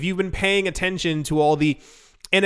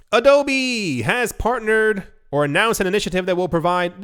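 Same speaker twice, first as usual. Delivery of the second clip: ordinary delivery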